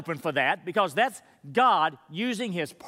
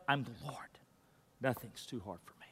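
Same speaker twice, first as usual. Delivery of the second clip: low, soft whisper, quicker on the second line; whispered voice of God; 8 semitones lower, 15 dB quieter than usual. Acted. Pastor voicing God to the worried, in a whisper. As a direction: breathe God's reassurance.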